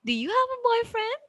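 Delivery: put-on quick, very high, "boyfriend" held up; rising intonation. Shown as a demonstration. Teacher contrasts a yes-no question, rising intonation. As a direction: rise steeply and keep "boyfriend" high. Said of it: "we have the rising intonation".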